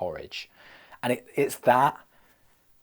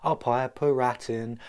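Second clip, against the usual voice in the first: Rat Piper; 4 semitones higher, slower than usual. Acted. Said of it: "montage of rats being piped in".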